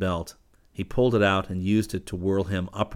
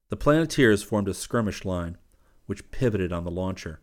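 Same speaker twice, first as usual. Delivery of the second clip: usual speaking voice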